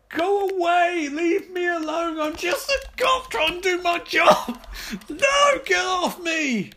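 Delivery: high, loud frantic yells; panicked shouting. Acted. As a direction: scream it like you're under attack. Playing a cop attacked by zombies.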